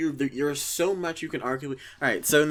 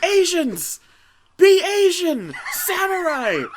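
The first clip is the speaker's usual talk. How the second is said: much higher, louder mock director shouting; Michael Bay; 6 dB louder, much slower than usual. Director shouting at the voice actor, mock yelling. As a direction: yell orders like an over-the-top director. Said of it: "he's just like".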